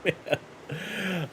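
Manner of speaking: high exclamation dropping to a faint sigh; helpless laughter. Real, not acted. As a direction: come down from a big laughing fit. Cracking up after the clip.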